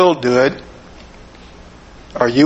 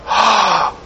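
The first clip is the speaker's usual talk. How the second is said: sharp gasped "Ah!" of amazement, pitched up; imitated audience gasp; 5 semitones higher than usual. Preacher mimics the audience's awed gasp at the Stradivarius. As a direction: gasp as if seeing a priceless violin. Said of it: "you could hear the audience go".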